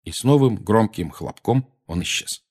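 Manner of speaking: plain reading voice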